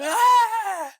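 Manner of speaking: loud, high yell of panic; mock panicked scream. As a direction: imitate a big guy shrieking as he hits water. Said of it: "freaking out".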